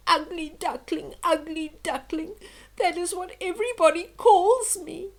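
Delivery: high, loud tearful wail; a little duckling sobbing his heart out. Acted. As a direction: cry it out like a hurt child. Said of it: "he sobbed".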